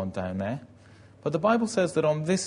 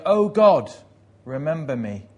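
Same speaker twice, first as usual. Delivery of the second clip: great burst of prayer; 3 semitones higher, 9 dB louder than usual. Retold or imitated. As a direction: burst out praying at full voice. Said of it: "in one great burst is praying".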